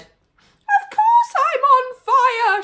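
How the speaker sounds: very high, very loud slow howl; grandma howling that she is on fire; yell. A narrator's reading. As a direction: wail it in burning agony. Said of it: "she yelled".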